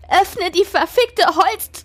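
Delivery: high, drawn-out desperate scream. A narrator's reading. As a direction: scream it with all your strength. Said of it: "schrie mit aller Kraft".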